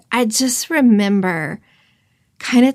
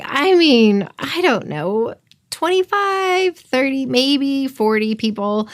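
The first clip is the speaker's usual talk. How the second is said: high, slow, hesitant guessing; unsure guess; 5 semitones higher, slower than usual. Retold or imitated. To the restaurant owner, reliving an unsure high slow guess at the numbers. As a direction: stretch out the numbers as if making them up on the spot.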